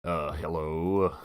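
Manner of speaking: low, soft, leathery hello; attempted Tom Selleck. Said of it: "a Tom Selleck impression".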